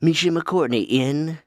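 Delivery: usual speaking voice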